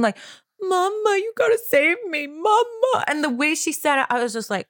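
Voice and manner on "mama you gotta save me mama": put-on much higher, crying little-girl plea; high crying voice of a toddler desperate for mom. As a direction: beg like a frightened toddler. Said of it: "desperately seeking".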